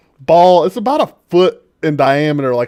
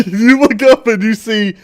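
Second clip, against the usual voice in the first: laughing; 7 semitones higher, 5 dB louder than usual. Real, not acted. GM laughs mid narration.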